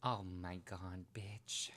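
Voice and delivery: breathy shocked whisper. Real, not acted. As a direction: gasp it under the breath. Shocked by the fantasy.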